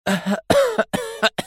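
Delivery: high-pitched weak coughs; coughing fit. A narrator's reading. Mona coughs as she regains consciousness. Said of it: "سعلت فيها منى".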